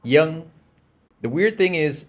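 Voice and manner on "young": put-on "young" said high and loud with a Y sound for V; Southern Vietnamese name demo. Shown as a demonstration. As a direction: model "young" for students to copy. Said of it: "makes a Y sound".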